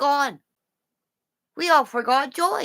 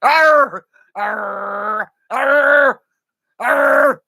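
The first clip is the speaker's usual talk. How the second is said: growled barks, louder; mock growly barks; dog barking; 3 semitones higher, 4 dB louder, much slower than usual. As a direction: bark like a dog. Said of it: "You want me to bark?".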